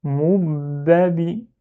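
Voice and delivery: "Moubabi" slowly, first b held as "moub-babi"; doubled b. Shown as a demonstration. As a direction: linger on the closed b inside "Moubabi". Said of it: "le ba avec kasra à la fin".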